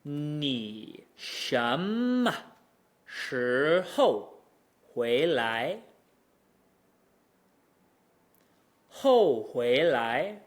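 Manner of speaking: 你什么时候回来 stretched very slowly, syllable by syllable. Shown as a demonstration. Teacher slowing the question so students hear each tone. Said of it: "Let's look at another".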